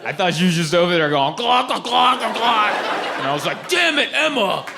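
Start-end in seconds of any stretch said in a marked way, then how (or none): 1.4-2.7 high-pitched imitated water glugging; exaggerated glug-glug noises; obnoxious gulping. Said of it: "obnoxiously drinking water". 3.7-4.6 high-pitched mock-annoyed scolding; hammed-up exasperated husband; mock exasperation. Irritated husband scolds sleeping-hours noise.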